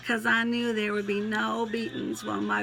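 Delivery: animated, twangy verse reading; twangy poem recital. Acted. Poet recalls granddaddy's farm, twangy recital. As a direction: recite it heartily with a country twang.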